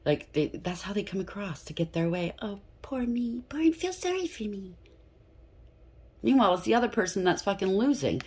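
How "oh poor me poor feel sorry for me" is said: pitiful poor-me act. Acted. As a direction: put on a high little woe-is-me whine. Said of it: "Somebody's looking pitiful here".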